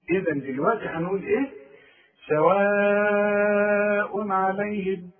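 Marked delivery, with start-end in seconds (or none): 2.3-5.1 سواء عليهم with a very long madd and clear tanween, no ghunna; long madd, no nasal. Shown as a demonstration. Teacher reciting سواء عليهم very slowly. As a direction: stretch سواء, then say عليهم cleanly. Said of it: "كل تنوين بعده عين ما غنش".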